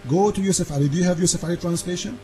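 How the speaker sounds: ordinary talk, nothing marked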